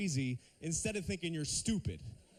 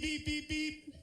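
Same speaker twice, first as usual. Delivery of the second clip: honk imitation; 8 semitones higher, much slower than usual. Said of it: "out of nowhere, beep".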